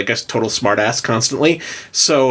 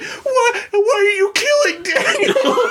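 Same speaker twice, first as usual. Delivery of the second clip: high, slow aghast question, laughing; horrified friend; slower than usual. Retold or imitated. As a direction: act shocked at the bloody scene. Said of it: "Tommy comes in and is just like".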